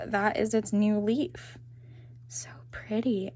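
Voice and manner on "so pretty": hushed whisper of admiration. Real, not acted. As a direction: breathe it out softly, in awe. Admiring the new leaf.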